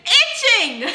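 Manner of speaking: laughing through the words; can't keep a straight face; giggling as the itch comes back. Real, not acted. Laughing while retelling the itch.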